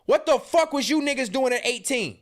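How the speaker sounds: ordinary delivery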